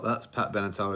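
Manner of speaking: ordinary delivery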